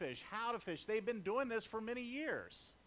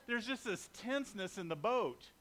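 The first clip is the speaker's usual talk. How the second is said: normal talking voice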